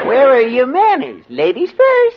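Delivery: squeaky high mock-polite voice; high and prissy, like an elf. Husband pushed to act elf.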